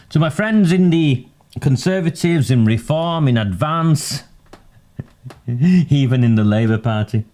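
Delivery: sarcastic greeting. Real, not acted. Vlogger greeting rival parties' viewers, mock-cheery and laughing. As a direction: greet rivals with teasing glee.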